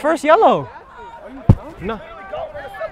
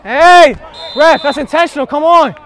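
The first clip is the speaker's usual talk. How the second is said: loud appeal for a foul; shouting at the ref; 4 semitones higher, 9 dB louder than usual. Real, not acted. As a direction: yell your complaint to the referee. Player appealing to the referee.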